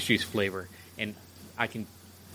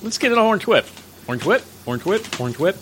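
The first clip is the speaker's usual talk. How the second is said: higher, louder excited chant; excited movie quote; 10 semitones higher, 5 dB louder than usual. Retold or imitated. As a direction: hype up the orange whip. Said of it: "He ad-libbed the whole, like".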